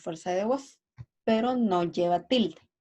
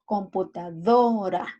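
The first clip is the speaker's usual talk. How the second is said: computaDOra with DO stretched and louder; stress on DO; 4 dB louder than usual. Shown as a demonstration. Teacher showing students the tonic syllable, stress on DO in computadora. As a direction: drag out and punch DO in computadora. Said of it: "En la sílaba do recae la acentuación".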